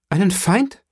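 high, indignant echoed question; indignant cry. A narrator's reading. Mercedes challenges her cousin's talk of an enemy, indignant. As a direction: fling it back, pitch leaping up in outrage. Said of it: "rief Mercedes mit einem zornigen Blick".

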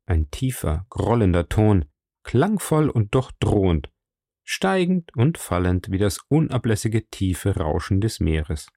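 ordinary delivery, nothing marked

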